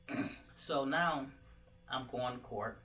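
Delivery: ordinary delivery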